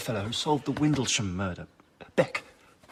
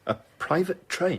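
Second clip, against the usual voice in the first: higher-pitched astonished echo; incredulous; 6 semitones higher than usual. Acted. Host hears Beck hired a whole train, incredulous. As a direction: repeat it in disbelief.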